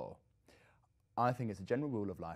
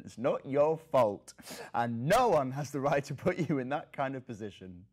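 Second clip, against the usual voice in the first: loud, raised, impassioned insistence; emphatic insistence; 6 semitones higher, 12 dB louder than usual. Acted. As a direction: insist on it, voice rising high and loud. Insists victim is not to blame.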